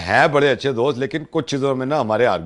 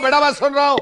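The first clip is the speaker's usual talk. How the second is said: much higher, raised voice; insistent calling over; 12 semitones higher, faster than usual. Pretend. Prank uncle talking over protesting victim, insistent. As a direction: cut through with a high, emphatic call.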